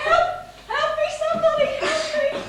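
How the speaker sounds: loud, high laughing cries for help; calling for help. Acted. Wife calling for help, loud and high. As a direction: cry out for help half laughing.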